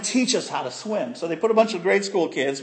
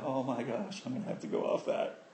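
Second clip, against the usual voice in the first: scared murmur; 6 semitones lower, 14 dB quieter than usual. Retold or imitated. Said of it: "And I'm going".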